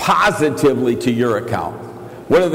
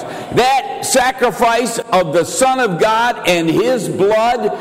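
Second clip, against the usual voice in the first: full-force pulpit preaching; fervent preaching; 9 semitones higher than usual. Acted. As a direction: preach it out to the crowd.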